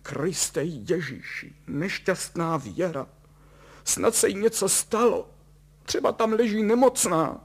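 high, wailing despair; hammed-up tearful mother; sobbing lament. A narrator's reading. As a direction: wail for the poor girl, rising in distress. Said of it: "V tom zavzlíkala".

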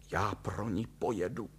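soft, broken tearful resolve; hushed, weepy mother; sobbing. A narrator's reading. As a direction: declare it weakly through sobs. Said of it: "prohlásila štkajíc".

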